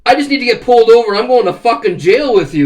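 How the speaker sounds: loud, high, heated scolding; loud high rant about getting busted; retold angry panic. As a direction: rant at him in fear of arrest. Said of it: "I'm like".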